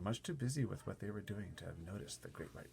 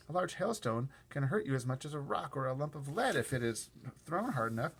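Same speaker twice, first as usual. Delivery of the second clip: plain reading voice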